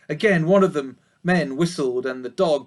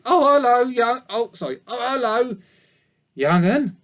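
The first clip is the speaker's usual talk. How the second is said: put-on high, louder old ewe, puffing; puffing old sheep; 6 semitones higher, 4 dB louder than usual. A narrator's reading. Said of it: "puffed the old sheep".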